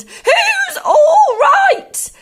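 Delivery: high, very slow, loud screech; indignant yell. A narrator's reading. Grandma rejecting George's reassurance, outraged drawn-out yell. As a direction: stretch the question into a shriek. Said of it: "she yelled".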